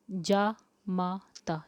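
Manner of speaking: जा माता very slow, long aa in जा; spelled-out pronunciation. Shown as a demonstration. Teacher spelling जामाता for students, very slowly. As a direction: draw out जा माता syllable by syllable. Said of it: "जा पे आकार डंडा लगेगा".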